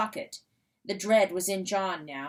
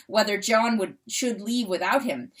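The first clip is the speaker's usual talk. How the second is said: plain reading voice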